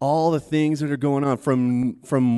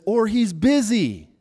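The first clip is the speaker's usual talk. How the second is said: mocking taunt; 8 semitones higher, 5 dB louder than usual. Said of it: "The next one he says".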